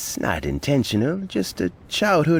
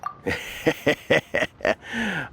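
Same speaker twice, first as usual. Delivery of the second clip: pleased chuckle; 7 semitones higher than usual. Acted. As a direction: chuckle modestly at the praise. Old man laughs at Ganyu's praise.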